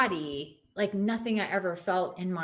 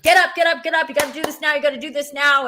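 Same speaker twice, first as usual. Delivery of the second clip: higher, louder urgent commands; urgent shouting; 6 semitones higher, 5 dB louder, much faster than usual. Retold or imitated. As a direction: shout it like a voice right in her ear. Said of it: "somebody shouting in my ear".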